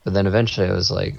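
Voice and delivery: ordinary talk, nothing marked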